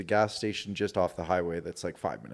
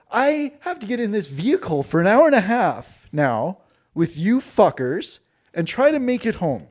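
exasperated outburst; 8 semitones higher, 6 dB louder than usual. Retold or imitated. Exasperated retort to Jeremy.

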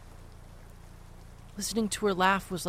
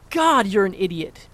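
angry; 9 dB louder than usual. Acted. Son's anger boils over.

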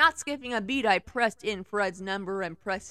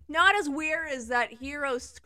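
ordinary delivery, nothing marked

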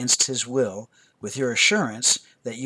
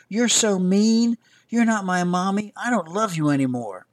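put-on a toddler yelling at his mom mid-tantrum; screaming tantrum; 7 semitones higher, 5 dB louder than usual. A narrator's reading. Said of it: "declare loudly".

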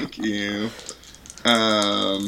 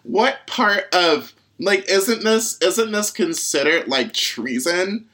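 outraged shouting; 9 semitones higher than usual. Acted. Character outraged, shrill disbelief.

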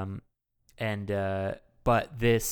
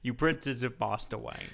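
put-on British actor's accent; Michael Caine. Mashing up two movie lines, mock British accent. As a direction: mimic a British butler, jokingly. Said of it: "he was like".

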